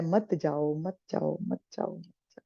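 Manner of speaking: hushed plea fading almost to nothing; soft pleading. Acted. Tara begs Bali not to go fight, pleading in a fading hush. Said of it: "विनती कर रही है".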